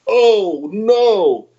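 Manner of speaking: wysoki, zaskoczony jęk. Retold or imitated. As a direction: nagle wyższym głosem, przejęty. Coach hears fighter hospitalized.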